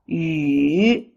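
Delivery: "yu" dipping low, then up; falling-rising tone. Shown as a demonstration. Teacher leads repeat drill, dipping yu. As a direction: sink then lift a long "yu". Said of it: "The third tone is the falling rising tone".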